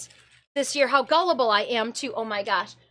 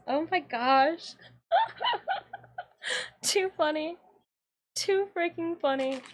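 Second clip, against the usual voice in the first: softly laughing while talking; giggling aside; 3 semitones higher, 4 dB quieter than usual. Real, not acted. Laughing after the story.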